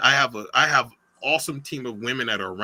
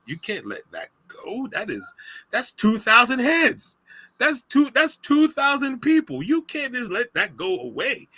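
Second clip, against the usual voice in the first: retold disbelief; 11 semitones higher than usual. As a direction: plead in high-pitched disbelief at losing something big. Retelling his alarmed protest.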